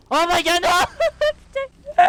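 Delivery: high, panicked shriek of fright; startled scream. Real, not acted. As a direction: yelp in sudden terror. Enemy jumps out, player startled. (cri de frayeur aigu)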